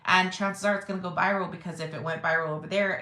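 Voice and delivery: ordinary talk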